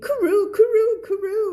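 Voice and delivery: high, loud bird call; bright pigeon coo. A narrator's reading. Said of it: "เสียงนก".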